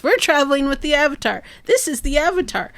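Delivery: high, rattled-off mock bragging; boastful Katara parody. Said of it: "plays that Avatar card every chance she gets".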